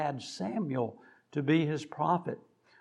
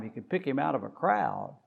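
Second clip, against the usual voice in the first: usual speaking voice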